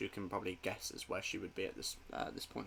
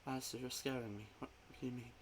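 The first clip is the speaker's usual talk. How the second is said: frightened and soft; 3 semitones higher, 9 dB quieter, faster than usual. Acted. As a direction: pull back, frightened.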